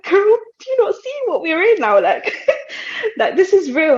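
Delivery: high, loud exasperated laugh; half laughing, half panicking at her friend; laughing disbelief. Retold or imitated. Retold reply to excited friend.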